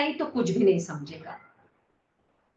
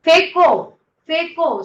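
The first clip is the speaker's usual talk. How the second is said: raised, forceful coaching call; urging command; 5 semitones higher, 7 dB louder than usual. Real, not acted. Teacher urging the class to throw it out, forceful call. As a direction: drive the group with a louder, higher push.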